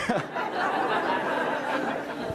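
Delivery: high breathy laugh rolling on. Real, not acted. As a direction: laugh hard at your own joke. Laughs at own punchline.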